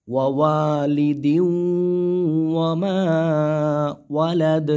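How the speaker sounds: slow melodic recitation; Quran recitation. Shown as a demonstration. Teacher models the phrase for students, slow chant. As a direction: chant it slowly.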